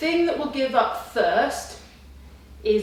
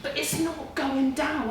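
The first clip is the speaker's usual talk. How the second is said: higher, slow, strained; pleading that the food won't go down; mock distressed answer; anxious reply; 6 semitones higher, 3 dB louder, slower than usual. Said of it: "And I'm like".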